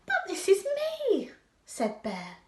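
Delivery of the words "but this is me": higher, louder puzzled protest; very much surprised. A narrator's reading. Pooh told he is elsewhere, surprised. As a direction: lift the voice in surprise. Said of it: "very much surprised".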